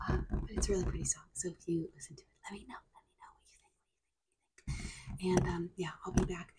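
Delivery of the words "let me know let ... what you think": fast whispering asmr. Acted.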